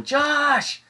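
high, drawn-out yell of a name; mock yell. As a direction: imitate her yelling across the store. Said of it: "she's just yelling".

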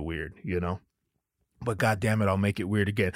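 low mellow friend. Retold or imitated. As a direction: play the calm friend brushing it off.

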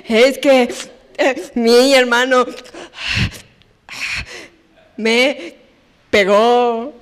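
lightly voiced sniffly child; a hint of a kid telling on her brother; child complaining. Little girl telling parents her brother hit her. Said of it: "y yo cuando logras calmarte".

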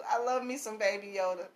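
usual speaking voice

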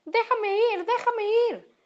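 high-pitched, frantic squeal; squealing plea. A narrator's reading. Little pig struggles in the fox's grip, squealing plea. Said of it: "El cerdito pataleaba y chillaba".

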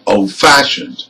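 put-on "Old-fashioned" with the weight on FASH; stress on FASHIONED. Shown as a demonstration. Teacher gives another compound adjective, "Old-fashioned" stressed on FASHIONED. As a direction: keep Old light, hit FASHioned in "Old-fashioned". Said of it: "and we stress fashioned".

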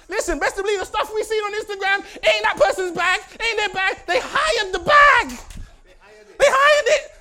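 high, loud preacher shout; full-force preaching. Acted. Preacher scolds the Instagram generation, shouting.

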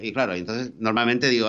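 ordinary talk

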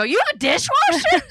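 high, loud, mock-astonished squeal; amazed. Retelling her phone date about appliances, loudly amazed. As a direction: exclaim as if it's an unheard-of luxury. Retold amazement at date's dishwasher.

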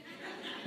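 a short unvoiced laugh; a quick breathy chuckle. Real, not acted. Laughs at own story.

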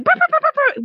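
high, loud yapping little dog; yappy dog bark imitation. Imitating the neighbor's yappy dog for the listener, high yaps. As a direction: yap like the neighbor's tiny dog. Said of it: "has a very yappy dog".

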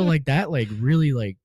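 ordinary talk

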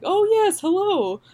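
high, swooping greeting; Michael Silverblatt. Retold or imitated. Friend finally spots her at the theater, high swooping hello. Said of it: "And he was like".

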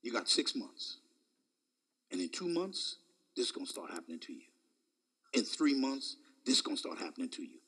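lightly voiced doctor giving a bad report; doctor's report. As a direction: rattle it off like a doctor. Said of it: "they'll tell you".

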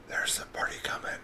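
soft passed-on whisper. Acted. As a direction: whisper it down the line. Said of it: "Telephone game".

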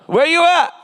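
high, hollered call to the crowd; rallying pulpit yell, pitch way up; call-and-response shout. Real, not acted. Calling to right side of congregation.

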